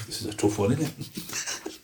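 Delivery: low, hushed, almost breathless musing; angel of death musing. Acted. Angel of death sizing up a soul, hushed musing. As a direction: weigh the soul quietly, under your breath.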